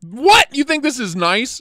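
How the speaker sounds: high, loud incredulous outburst; loud disbelief. Real, not acted. Streamer challenges a viewer who likes the knife, incredulous. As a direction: shoot up high on "What".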